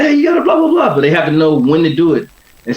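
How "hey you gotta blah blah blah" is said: sharp, much higher yelled order; yelling cornerman. Retold or imitated. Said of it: "that one person yells at you".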